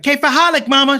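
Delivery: loud, high, eager Arabic greeting to mom. Retold or imitated. Son greeting his mom with Arabic phrase, eager and loud. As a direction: show off the new phrase.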